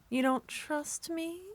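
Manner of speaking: ordinary talk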